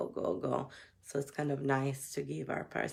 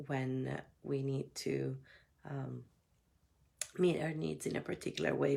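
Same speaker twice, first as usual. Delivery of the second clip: soft, gentle meditation guide; guided meditation; 3 dB quieter than usual. Acted. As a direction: ease them inward, gently. Opens with a guided check-in.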